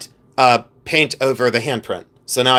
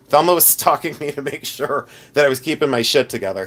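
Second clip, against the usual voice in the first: ordinary talk, nothing marked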